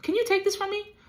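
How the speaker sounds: high pleading plea to the Father; pleading Jesus. Acted. Preacher voices Jesus begging God in the garden, high and pleading.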